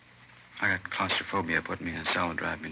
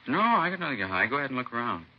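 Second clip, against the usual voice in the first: defensive protest; faster than usual. Acted. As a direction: protest innocence with a rising pitch. Suspect protests he hides nothing.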